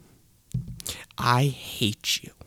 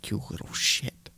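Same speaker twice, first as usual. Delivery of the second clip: hissed under the breath, unvoiced; seething whisper; 7 dB quieter than usual. Cursing Kevin Beck under his breath, seething hiss. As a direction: spit it out in a hateful whisper. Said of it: "I am seething with rage".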